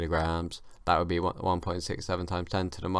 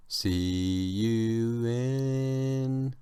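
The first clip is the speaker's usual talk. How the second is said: extremely slow, stretched words with climbing pitch; drawn-out sign-off; 3 semitones higher, much slower than usual. Pretend. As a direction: hold each word out.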